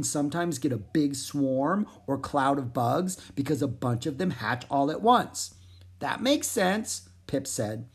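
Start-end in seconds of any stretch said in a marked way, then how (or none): 6.0-7.1 a slow, higher frog voice; little frog thinking aloud. Acted. Pip agreeing with Zoe, in a slow higher voice.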